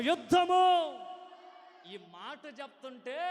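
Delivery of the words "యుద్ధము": very high loud shouted యుద్ధము; even louder shout. Real, not acted. Preacher pushing the congregation for more, full shout. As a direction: give it everything on యుద్ధము. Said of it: "ఇంకొంచెం".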